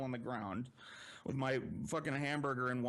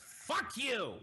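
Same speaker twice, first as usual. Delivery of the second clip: pitched way up, a snapping mock shout; shrill mock yell; 12 semitones higher than usual. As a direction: mimic the sister's high furious outburst. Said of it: "she sits bolt upright".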